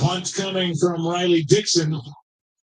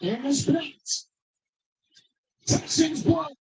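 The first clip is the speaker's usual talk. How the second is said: higher, suddenly shouting the block; excited call; 6 semitones higher, 5 dB quieter than usual. Real, not acted. Blocked punt called live.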